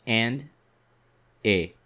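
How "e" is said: E said as a French schwa; isolated French vowel E; French E sound. Shown as a demonstration. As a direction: sound out E on its own. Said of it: "Here we have the sounds".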